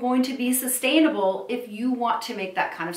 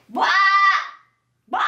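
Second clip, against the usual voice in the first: put-on baby squawk; 8 semitones higher, 5 dB louder than usual. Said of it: "the baby would just squawk".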